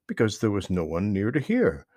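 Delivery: usual speaking voice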